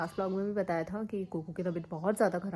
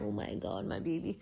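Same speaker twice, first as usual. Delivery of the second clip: soft pity; 4 semitones lower, 7 dB quieter, much slower than usual. Real, not acted. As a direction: hush it out with pity. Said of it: "मुझे भी रोना आता है".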